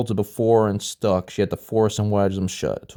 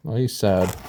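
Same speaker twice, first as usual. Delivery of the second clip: ordinary delivery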